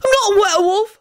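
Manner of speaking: high-pitched angry denial; wrathful shout. A narrator's reading. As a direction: protest in loud outrage. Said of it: "the boy shouted wrathfully".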